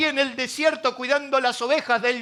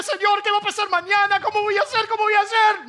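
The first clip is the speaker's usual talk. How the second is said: high, pleading cry of worry; anxious complaint; 8 semitones higher, 4 dB louder, faster than usual. Acted. Israel complains anxiously to God about tomorrow, loud pleading whine. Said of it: "Israel se enojaba y decía".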